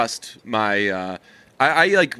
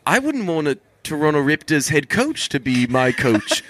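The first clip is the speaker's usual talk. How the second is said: higher-pitched imitated New Zealand accent; 4 semitones higher than usual. Quoting a podcaster rejecting the Raptors coach, New Zealand accent. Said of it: "it sounds so awesome with New Zealand accents".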